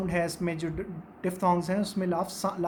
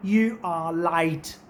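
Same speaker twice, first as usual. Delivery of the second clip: very slow Australian "You are light" for "late"; a light imitation of an Australian saying "You are light"; Australian accent; 4 semitones lower, much slower than usual. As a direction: drawl it the Aussie way, ai for ay. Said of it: "वहाँ पे वो कहेंगे".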